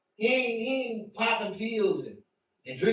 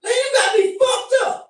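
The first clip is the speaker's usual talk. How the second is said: much higher and louder, caught off guard; startled outburst; 7 dB louder than usual. Retold or imitated. Reacting to a stranger who says he looks like someone, high loud outburst. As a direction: jump into a high, loud snap of outrage. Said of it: "it just make you jump like".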